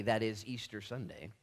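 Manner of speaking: ordinary talk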